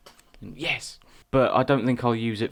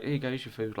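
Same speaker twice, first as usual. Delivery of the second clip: softer, offhand shopkeeper line; mock dismissive mumble; 10 dB quieter than usual. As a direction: hand over the food without interest, quietly. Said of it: "She was like".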